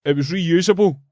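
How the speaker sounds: high, huffy mock voice; high mock-prissy protest; indignant stranger. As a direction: mimic a prissy, offended dad. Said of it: "Johnny Excitement jumps round going".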